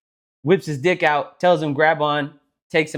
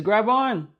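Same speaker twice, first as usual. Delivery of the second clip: pitched up offhand command; high mock voice; 10 semitones higher than usual. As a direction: toss it off like a cartoon elephant.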